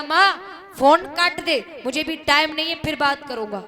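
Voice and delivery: loud, impatient brush-off; dismissive son. Acted. Son brushing off mother on phone.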